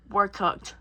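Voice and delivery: low, understated doom; deadpan it as a doomed crewman; lightly acted, sinking realization; mock resigned dread. Crew realizing they are doomed.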